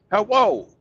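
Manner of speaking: Hello said as hawo with W for L, loud and high; mock Japanese accent. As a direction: imitate the businessmen. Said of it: "they would say".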